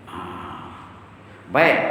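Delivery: ordinary talk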